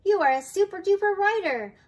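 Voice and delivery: put-on high, loud cheering for a child; storybook grandma cheering the rider on; excited praise. A narrator's reading. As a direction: gush with big, lifted praise.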